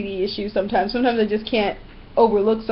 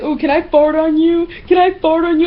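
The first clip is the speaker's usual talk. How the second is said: higher, singled-out silly imitation voice; mock childish pestering; 6 semitones higher, 4 dB louder than usual. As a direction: imitate an annoying overgrown kid.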